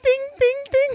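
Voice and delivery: high-pitched dings; ping it out high like a faulty table; imitated dinging. Mimicking the traction bed, high pinging dings.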